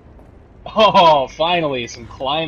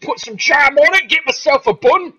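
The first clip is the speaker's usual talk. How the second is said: hammed-up fake Cockney British accent, much higher and louder; bad British accent; 9 dB louder than usual. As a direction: bellow it like a cartoon London geezer. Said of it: "people doing really, really bad fake British accents".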